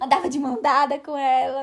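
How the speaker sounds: ordinary talk, nothing marked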